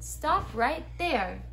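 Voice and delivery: mild mock-angry yell. A narrator's reading. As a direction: order a halt, each word drawn out. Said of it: "an angry voice yelled".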